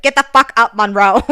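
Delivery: loud, high mock shout from the boss; mock boss yell. Boss snapping at the server to get up, mock yell. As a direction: bark it like a rude boss, half-joking.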